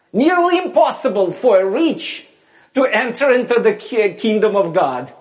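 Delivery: emphatic lament. Acted. Yeshua laments to disciples.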